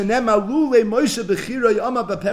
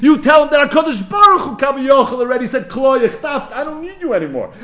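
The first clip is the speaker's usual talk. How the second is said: much louder and higher, near shouting; impassioned urgent charge; 7 semitones higher, 8 dB louder than usual. Retold or imitated. Moshe commands Elijah to warn Mordechai, near shouting. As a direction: drive the message home.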